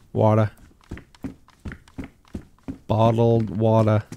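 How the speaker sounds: Boston accent, "water" as wah-tuh, no R; exaggerated Boston accent. Shown as a demonstration. Player overdoing own speech, Boston accent on "water". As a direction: drop the R in "water", heavy Boston style. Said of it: "It's not even really how I say it".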